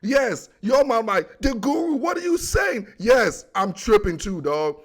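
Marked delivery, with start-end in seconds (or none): 1.4-2.9 high, disbelieving call-out; mock outrage at the claim; incredulous. Fan shouting at the Guru in disbelief.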